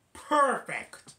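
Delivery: loud, high burst; eager agreement. Retold or imitated. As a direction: pounce on it, high and emphatic. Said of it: "but Alvin and Brittany say".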